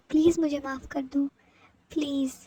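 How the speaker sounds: usual speaking voice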